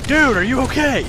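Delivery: high, urgent retold yell. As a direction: call out loudly to rouse a zoned-out friend. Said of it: "We're just yelling at him".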